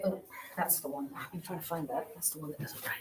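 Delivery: whispered to himself under his breath; soft muttered aside while searching for a marker. Real, not acted. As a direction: mutter it half to yourself, hunting for a pen.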